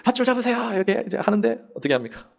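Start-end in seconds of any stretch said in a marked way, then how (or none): 0.0-0.7 higher call of a rescuer over a mic; 헬기 마이크로 외치는 구조대원 목소리; mock megaphone call. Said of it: "마이크로".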